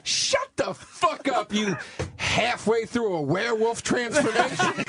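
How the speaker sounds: high, slow, drawn-out insult; imitated scornful put-down. Retold brutal put-down after a look.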